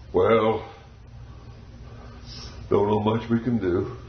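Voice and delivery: doctors' verdict. Retold or imitated. Doctors telling the parents, low and grave. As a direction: drop low like solemn doctors. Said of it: "with their godlike faces on and said".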